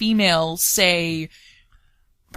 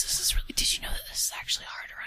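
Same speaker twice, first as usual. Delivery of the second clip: whispering; 9 dB quieter, much faster than usual. Pretend. Whispering in the game.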